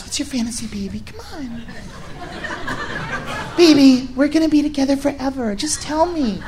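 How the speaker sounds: put-on soft, breathy coaxing girlfriend voice. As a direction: lure him into confessing.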